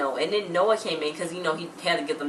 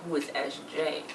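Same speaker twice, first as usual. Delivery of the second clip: hushed wonder; 3 semitones lower, 7 dB quieter than usual. Retold or imitated. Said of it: "she was real like".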